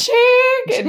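high, loud imitation of a register; mock cha-ching. Co-host mimicking his old cash register sound, high. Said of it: "It made no sense".

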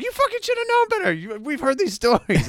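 mock angry roommate yelling high. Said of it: "he's like".

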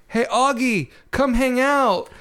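mild imitated call, pitch lifting; Isaiah. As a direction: call out casually to a friend, no real shout. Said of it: "I was yelling at you".

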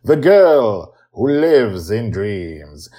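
big, bright announcer welcome; loud showman opening; podcast host intro. Acted. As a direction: sell the show like a radio host.

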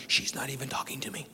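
breathy whispered protest; hushed aside. Retold or imitated. Whispering to God at the dinner table, protesting. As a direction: whisper it like a secret complaint.

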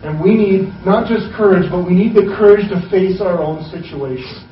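loud, driving sermon voice; urging the listeners to courage, loud preaching; preaching at full force. Acted. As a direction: press the congregation hard. Preaching climax.

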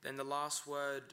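usual speaking voice

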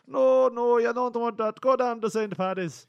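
high, hammed-up Irish brogue; mock Irish accent, lightly done; Irish cop voice. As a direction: play a jolly Irish beat cop with a brogue. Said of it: "I turned him into, like, a Irish cop".

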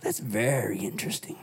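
a hushed, low pirate line; Pirates of the Caribbean quote. Retold or imitated. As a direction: drop it low and quiet like a film pirate. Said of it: "That's a Pirates of the Caribbean quote".

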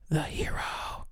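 hushed, voiceless pep-talk finish; dramatic whisper. Pretend. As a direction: whisper it for mock-epic effect.

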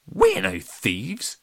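drawn-out, loud wounded denial; protest, stung by the accusation; hurt. A narrator's reading. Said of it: "looking hurt".

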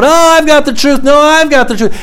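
high-pitched mock one-upmanship; hammed-up rival claims, pitched high; mock squabbling. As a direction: imitate people arguing over who is right. Mimics people competing over truth.

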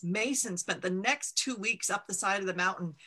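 usual speaking voice

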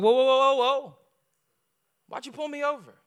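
high, loud whoa whoa; high loud startled whoas; incredulous protest. Retold or imitated. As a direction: stop him short, baffled. Protesting to the officer.

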